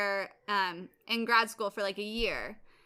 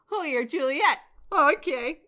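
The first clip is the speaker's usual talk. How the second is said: lightly hammed-up Fargo accent, pitched up and louder; mock Fargo accent; 6 semitones higher, 8 dB louder than usual. Girlfriend reacting to news of his Juliet, mock Fargo accent. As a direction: put on a bad Fargo accent. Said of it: "like, from Fargo".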